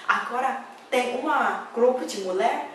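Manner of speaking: usual speaking voice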